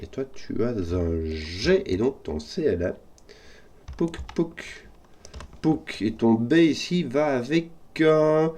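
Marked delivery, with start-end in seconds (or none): 4.0-6.0 high, light "pouc" popping noises; high mouth pops; playful pop sounds. Real, not acted. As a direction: pop each piece into place with a little sound. Sound effects placing puzzle pieces.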